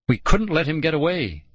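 plain reading voice

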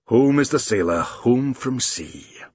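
格鲁姆布尔满足地低吟，放慢拖长; 像对自己轻声念诗一样慢慢说; 满足地. A narrator's reading. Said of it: "said Grumble contentedly".

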